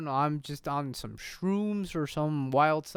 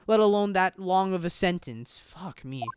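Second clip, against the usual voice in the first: ordinary delivery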